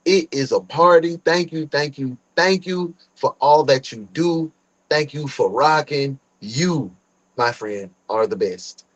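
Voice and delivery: ordinary talk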